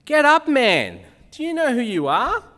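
loud, high, commanding rebuke; fiery Jezebel. Acted. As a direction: shake a sulking husband awake. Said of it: "to this husband of hers who's sulky and says".

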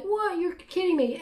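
retold reaction, pitched well up; imitated disbelief. Friend hears her date hasn't shown up, imitated high-pitched disbelief. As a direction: act out an incredulous friend.

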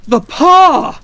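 very high, loud outburst; wild cry. A narrator's reading. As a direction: cry out wildly, as if suddenly possessed. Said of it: "she cried wildly".

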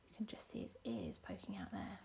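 quick, very soft near-whisper; low, hushed pointing-out; hushed aside. Real, not acted. Confiding picture detail to children.

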